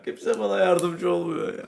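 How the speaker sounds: normal talking voice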